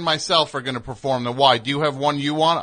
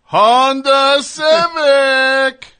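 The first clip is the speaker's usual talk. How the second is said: announcer punchline; 13 semitones higher, 11 dB louder, much slower than usual. Acted. Ad-style punchline to Kat.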